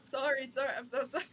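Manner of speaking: loud, flustered, rushed sorry; frantic remorseful pleading; panicked apology. Acted. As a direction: fret over what you did.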